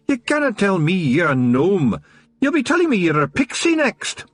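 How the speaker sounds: high, teasing Scots brogue; amused Scottish pixie. A narrator's reading. Rob Anybody teasing Wee Mad Arthur, high amused Scots. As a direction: rib him like a cheeky big brother.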